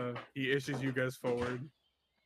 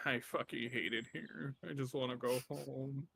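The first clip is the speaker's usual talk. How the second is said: softer, fed-up mutter; a guard muttering how miserable he is; grumbled aside; 10 dB quieter than usual. Acted. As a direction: grumble it under your breath. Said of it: "he goes like".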